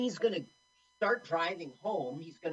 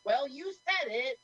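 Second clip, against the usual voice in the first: high, drawn-out mock innocence; passive-aggressive; 10 semitones higher than usual. Director dodging blame for the firing, passive-aggressive. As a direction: stretch it out high, putting the blame back on him. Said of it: "what a passive-aggressive asshole".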